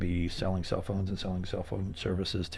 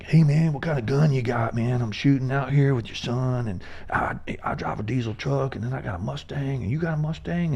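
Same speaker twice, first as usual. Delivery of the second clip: put-on higher, louder good ol' boy voice; bro voice; 6 semitones higher, 5 dB louder than usual. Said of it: "normally nineteen-year-old kids are like".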